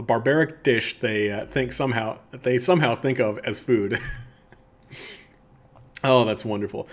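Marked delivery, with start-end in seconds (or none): none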